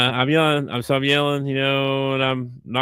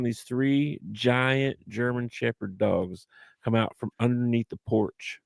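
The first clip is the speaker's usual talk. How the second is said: usual speaking voice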